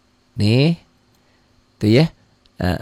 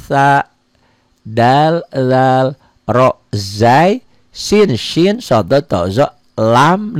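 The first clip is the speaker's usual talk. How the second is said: loud letter recitation; 4 semitones higher, 7 dB louder, much slower than usual. Shown as a demonstration. Teacher reciting the sun letters.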